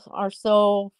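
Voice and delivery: usual speaking voice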